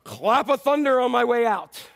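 loud, booming mock storm; storm's parting shot. Storm making a last noisy exit, blustering. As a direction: play a storm blustering as it exits. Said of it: "the storm did not even go".

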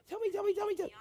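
high, soft, insistent whine; pestering kid. Retold or imitated. Kids in the back of the car, high and begging. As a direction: keep begging tell me in a high voice.

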